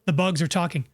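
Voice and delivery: ordinary talk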